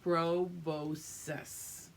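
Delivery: proboscis said slowly, syllable by syllable. Shown as a demonstration. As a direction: draw out proboscis syllable by syllable for the children. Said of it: "You just say that one".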